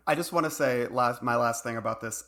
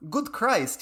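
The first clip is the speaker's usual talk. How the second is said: chef voice; 9 semitones higher than usual. Retold or imitated. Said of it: "he said".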